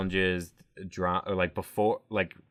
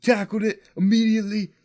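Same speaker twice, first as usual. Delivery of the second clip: high, loud, fast bro rant; macho bro; 13 semitones higher, 7 dB louder, faster than usual. Retold or imitated. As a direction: puff up like an angry gym bro. Mocking Paulie's tough-guy rant.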